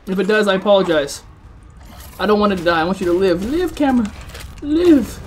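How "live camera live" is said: high, playful plea; mock pleading. Streamer to the dying camera, mock pleading. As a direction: beg it dramatically to hang on.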